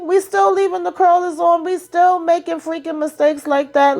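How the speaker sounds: exasperated call-out, voice rising high; incredulous rant. Real, not acted. Ranting at show characters, rising pitch.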